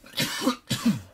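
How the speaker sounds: high sharp coughs; coughing. Real, not acted. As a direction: cough out the vapor. Coughing after second pull.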